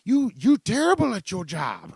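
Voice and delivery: high, loud, hammed-up Vietnamese accent; mock Vietnamese caller chewing him out; Vietnamese accent impression. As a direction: squawk it out as a scolding Vietnamese lady. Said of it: "some Vietnamese lady that just".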